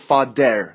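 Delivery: "father" said as "fa-der"; mispronounced th. Retold or imitated. Teacher imitating how people wrongly say it, d in "father". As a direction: replace the th of "father" with a plain d. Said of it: "اگه قرار بود father باشه، با علامت دی".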